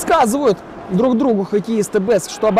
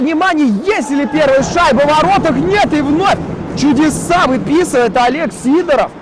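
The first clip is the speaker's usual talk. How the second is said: excited hockey goal call; 6 semitones higher than usual. Real, not acted. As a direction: shout it up as the puck nears the net. Near goal, big save call.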